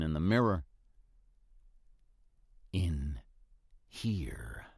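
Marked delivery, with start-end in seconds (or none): 2.7-4.7 breathless ghostly whisper; a dead woman's whispered answer; eerie whisper. Acted. Ghostly message read from mirror.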